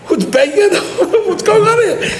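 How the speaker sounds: high, laughing, startled wife voice. Retold or imitated. Husband imitates wife woken by banging, high and laughing. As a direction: squeal it out as the wife jolted awake, laughing.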